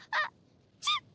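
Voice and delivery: high squeaked sneeze; sudden dainty "achoo"; very high-pitched little sneeze. Acted. Princess sneezes again.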